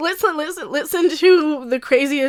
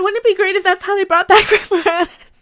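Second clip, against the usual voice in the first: laughing through tears; 7 semitones higher, 4 dB louder than usual. Real, not acted. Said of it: "I'm gonna cry again".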